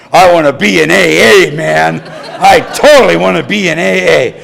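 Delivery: loud, thrilled blurt of resolve; eager shout. Retold or imitated. Newcomer tells his sponsor, excited loud shout. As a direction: burst out that you want in. Laughing, suddenly sure of joining.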